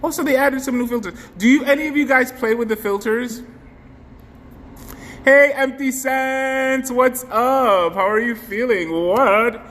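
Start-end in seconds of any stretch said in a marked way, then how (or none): 5.2-9.6 excited greeting. Acted. Greeting viewer.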